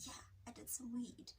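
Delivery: soft, unvoiced confession; guilty whisper. Telling her sister she did weed, whispered. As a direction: breathe the admission out in a whisper.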